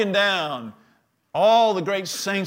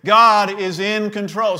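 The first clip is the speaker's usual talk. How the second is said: louder, higher punch on God; loud declaration; 4 semitones higher, 7 dB louder than usual. Real, not acted. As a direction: proclaim it to the whole room. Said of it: "Say it again".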